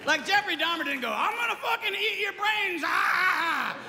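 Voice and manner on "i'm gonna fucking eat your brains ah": high hammed-up monster yell; over-the-top shouted threat; mock killer shout. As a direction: scream the threat like a cartoon killer. Mock killer announcing himself.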